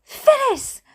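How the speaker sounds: very high wailed name; mother's wail. A narrator's reading. Mother finding her injured sister, wailing. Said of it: "wailed my mother".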